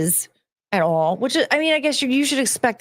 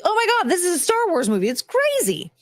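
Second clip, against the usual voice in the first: excited; 10 semitones higher than usual. Retold or imitated. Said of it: "you're like".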